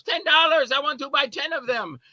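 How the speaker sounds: eager Lily. Retold or imitated. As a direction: pitch it up high like an eager buyer. Lily quoted, eager buyer.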